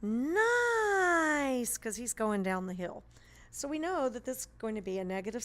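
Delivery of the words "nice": high, loud drawn-out cheer of a happy skier; delighted skier. Acted. Teacher voicing the skier enjoying the downhill, loud and high. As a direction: stretch it out like a joyful whoosh downhill. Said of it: "he's gonna run into the mountain and go".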